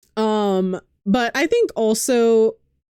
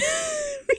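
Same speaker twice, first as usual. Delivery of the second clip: high giggle; 8 dB quieter than usual. Real, not acted. Laughs at loving Urianger.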